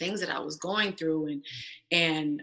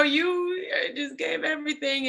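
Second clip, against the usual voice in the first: tearful plea; 8 semitones higher than usual. Retold or imitated. Retold child pleading with mother.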